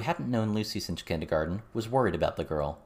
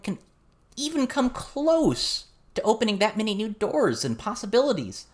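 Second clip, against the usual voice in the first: put-on much higher, louder, eager girl's voice; excited teen girl; 13 semitones higher, 4 dB louder than usual. Verona arguing to John about the magic world's scale, excited and high. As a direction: gush about a whole new world.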